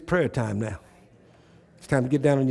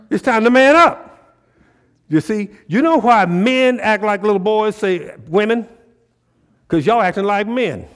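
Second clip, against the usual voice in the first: high, loud preacher challenging the room; fired-up preaching; 7 semitones higher, 8 dB louder than usual. Acted. Preacher challenging the men in church to man up, fired-up preaching. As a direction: push it out hard, stretching key phrases.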